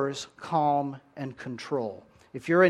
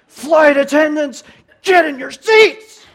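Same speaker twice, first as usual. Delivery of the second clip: loud, high barked order; panicked pilot shout; 12 semitones higher, 14 dB louder than usual. Retold or imitated. Pilot shouts at crew over intercom, loud and high. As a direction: yell it like the captain is losing it. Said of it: "if he gets on the intercom and says".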